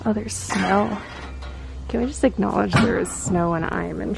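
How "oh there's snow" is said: usual speaking voice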